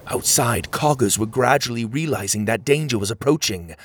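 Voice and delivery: plain reading voice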